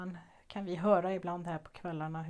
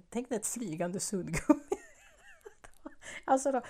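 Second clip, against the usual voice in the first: laughing; 6 semitones higher, 5 dB louder than usual. Real, not acted. Laughing while describing the moth.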